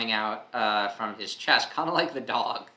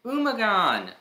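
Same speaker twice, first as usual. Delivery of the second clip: joyful cry; 11 semitones higher, 6 dB louder, slower than usual. Acted. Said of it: "That's Sunbeam saying that".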